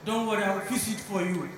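low, soft reassurance; calming. Acted. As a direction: soothe him, no hurry. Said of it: "The rich man say".

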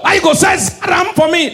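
high, indignant mock shout; outraged comic outburst; provoked shout. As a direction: yell it high, as if personally offended. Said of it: "Why you dey shout?".

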